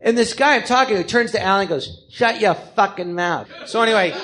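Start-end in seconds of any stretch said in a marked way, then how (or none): 2.1-3.5 slow, deliberate New York put-down; imitated curled-lip guy, drawn out; curled-lip New Yorker. New Yorker turns to friend Alan, slow curled-lip rebuke. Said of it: "top lip was curled under his teeth".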